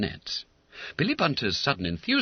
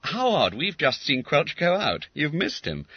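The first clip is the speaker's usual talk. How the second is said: schoolboy voice; 8 semitones higher than usual. A narrator's reading. Wharton voice.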